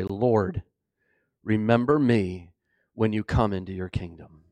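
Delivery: low, hushed, earnest prayer; humble plea. Acted. Criminal speaking to Jesus, humbly pleading. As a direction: plead softly, voice dropped low. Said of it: "He looked at Jesus and said".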